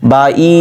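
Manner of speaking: বা ই with quick বা and held ই; short ba, long i. Shown as a demonstration. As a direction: keep বা short, stretch ই. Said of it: "বা টান দিচ্ছি না". (বা ছোট, ই টেনে পড়া)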